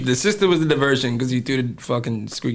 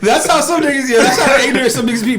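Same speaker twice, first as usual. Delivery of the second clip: laughing; 10 semitones higher, 5 dB louder than usual. Real, not acted. Laughing at ignorant friends.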